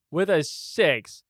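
normal talking voice

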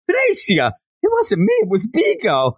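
put-on very high, squeaky girlish voice; high-voiced sisters. Little girl blames her puppet to her sister, very high girlish voice.

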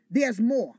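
loud, emphatic declaration; loud proclamation. Real, not acted. Preacher calling out to the congregation, loud proclamation. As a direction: proclaim it to the church.

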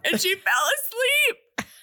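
high, slow, laughing outrage; laughing, mock dismay. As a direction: squeal it through a laugh. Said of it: "And I wrote".

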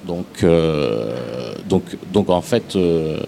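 ordinary delivery

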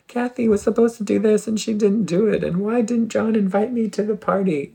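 high-pitched anxious complaining; frantic whiny brain. Retold or imitated. The anxious mind griping about everyone, high and whiny. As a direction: squeak out the petty grievances. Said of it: "our brain is like".

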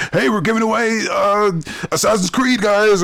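high-pitched, hammed-up hype; mock promotional excitement. Company distracting fans with a giveaway, in mock excitement. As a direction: imitate an overeager company pitch.

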